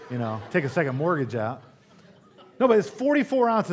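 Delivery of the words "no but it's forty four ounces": much higher, louder disbelief; incredulous exclaim. Real, not acted. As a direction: exclaim at the absurd size. Incredulous soda rant.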